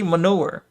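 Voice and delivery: manure said as muh-NOO-er; vindicated pronunciation. Shown as a demonstration. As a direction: say manure with an oo and no y glide. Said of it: "So I'm saying it right".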